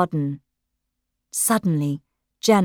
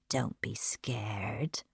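slow, low, softer whisper; menacing whisper; 8 semitones lower, 16 dB quieter, much slower than usual. Acted. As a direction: whisper it close to her face. Intruder closing in, whispering.

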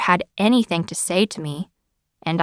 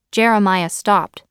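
ordinary delivery

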